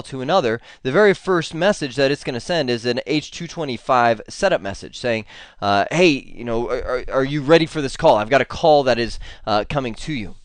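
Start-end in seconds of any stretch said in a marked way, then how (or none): none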